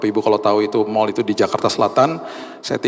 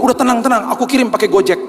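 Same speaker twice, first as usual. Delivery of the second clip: higher, louder calming wife's voice; wife on the phone; 11 semitones higher, 3 dB louder than usual. Retold or imitated. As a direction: play the wife soothing him, pitched up. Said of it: "dia bilang begini".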